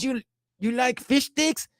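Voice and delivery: high, slow mock Mexican accent; Carlos Mencia. Comedian sets up the joke, mock Mexican accent. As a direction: stretch out the question in a cartoonish Mexican accent. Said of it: "his own Mexican accent".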